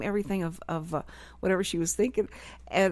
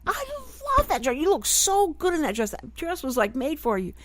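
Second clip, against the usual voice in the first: a much higher, bubbly rave about the dress; gushing mom; 9 semitones higher than usual. Retold or imitated. Mom answering her daughter about a dress, gushing high. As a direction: pile on the praise like a cheerleader mom.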